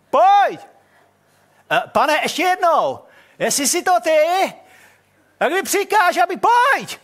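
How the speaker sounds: high, loud, slow pleading call; hammed-up eager call. Peter calls from the boat to Jesus, loud and pleading. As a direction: shout it across the water, overeager.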